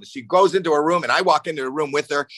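normal talking voice

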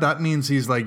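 normal talking voice

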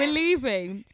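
mock sob. Fake crying over KFC.

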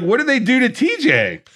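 high, incredulous cry of outrage; mock outrage. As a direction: act out a fan's appalled disbelief. Acting out a viewer's outraged reaction.

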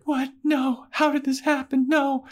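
high mock-panicked protest; shocked Buffy. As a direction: squeak out disbelief like a horrified girl.

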